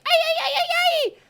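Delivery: extremely high, loud, laughing cries; wild jumping yelps. Acted. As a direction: whoop while bouncing through the air.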